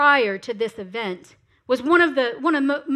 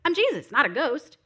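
light, chummy parody voice; casual Jesus; 3 semitones higher than usual. Acted. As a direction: play it as an offhand buddy brushing off worry. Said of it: "This isn't just Jesus saying".

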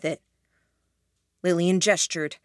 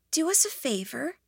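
breathy voice; 8 semitones higher, 5 dB quieter than usual. A narrator's reading. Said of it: "in that same breathy voice".

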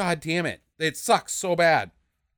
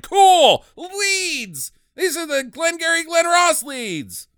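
excited shout; 9 semitones higher, slower than usual. Retold or imitated. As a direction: shout it like a jackpot. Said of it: "they're like".

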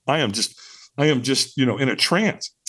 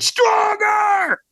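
very high, drawn-out mock drill shout; retold scream. Trainer screamed at a holdback firewalker, full-stretch shout. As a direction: scream the command as the exasperated trainer. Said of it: "he screamed at me".

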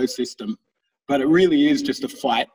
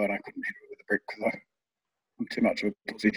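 much softer, breathy admission; sheepish mumble; 4 semitones lower, 9 dB quieter, much faster than usual. Real, not acted. Admitting to friends he let the mouse go, sheepish murmur. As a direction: confess it under your breath.

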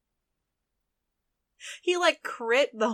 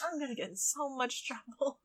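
muttered acted dismay; 12 dB quieter than usual. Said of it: "He's like".

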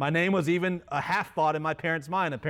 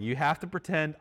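normal talking voice

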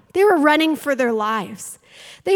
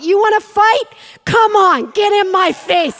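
defiant challenge; 5 semitones higher, 5 dB louder than usual. Acted. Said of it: "a generation who's gonna say".

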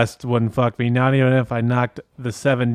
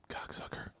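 whisper; 26 dB quieter than usual. Acted. Whispered insult.

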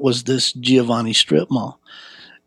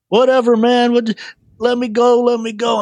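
high-pitched, urgent defiance; retold protest; 11 semitones higher than usual. Grabbed teenager being shaken by the big man, protesting. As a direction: act out struggling to be released.

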